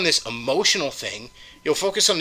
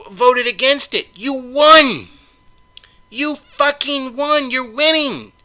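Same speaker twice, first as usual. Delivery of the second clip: angry shouting; 9 semitones higher, 3 dB louder, slower than usual. Real, not acted. Ranting at liberals, yelling.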